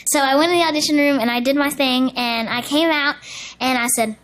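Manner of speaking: ordinary talk, nothing marked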